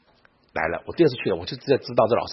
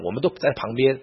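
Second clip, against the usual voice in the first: normal talking voice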